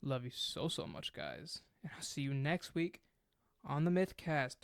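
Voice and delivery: ordinary talk, nothing marked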